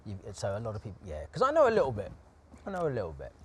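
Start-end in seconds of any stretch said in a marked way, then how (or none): 1.3-2.1 high, loud proud boast; proud exclamation. Real, not acted. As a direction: shout it out with pride. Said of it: "We can tell".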